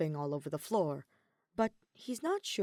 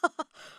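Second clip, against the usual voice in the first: short disbelieving laugh; incredulous laugh; 5 dB louder than usual. A narrator's reading. Kaveh laughing in disbelief at Alhaitham. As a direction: laugh at the absurd idea. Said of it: "He laughs incredulously".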